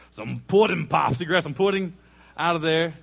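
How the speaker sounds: playful emphasis. Real, not acted.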